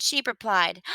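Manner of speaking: ordinary delivery, nothing marked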